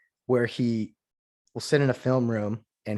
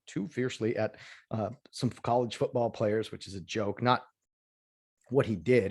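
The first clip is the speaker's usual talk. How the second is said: ordinary talk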